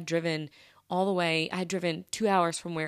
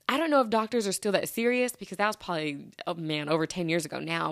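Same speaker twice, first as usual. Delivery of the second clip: ordinary delivery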